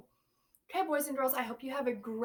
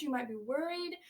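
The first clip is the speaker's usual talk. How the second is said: ordinary delivery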